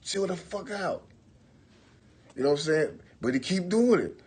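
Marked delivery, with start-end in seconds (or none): 0.0-1.0 higher, tense and pleading; nervous. Retold or imitated. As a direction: urge him to stop, scared. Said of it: "I'm like".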